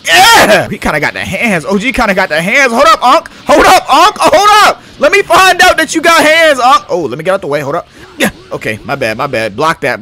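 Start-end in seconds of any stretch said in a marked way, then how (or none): 0.0-0.7 loud hype cheer; excited yell. Real, not acted. Cheering in fight. 2.7-6.9 loud, high, amazed; hyped shout. Real, not acted. Hyped by enemy's strength.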